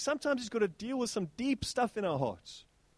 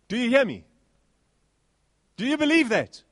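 louder challenge; 3 semitones higher, 5 dB louder than usual. Acted. Repeated challenge to congregation.

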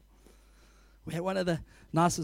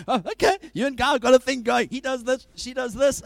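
amused surprise; 8 semitones higher, 5 dB louder than usual. Retold or imitated. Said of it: "I was like".

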